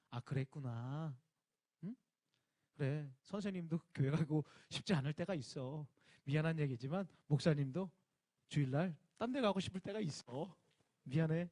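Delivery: a teacher soothing a child, lightly voiced; gentle teacher. Pastor plays a kind teacher to a child, soft and gentle.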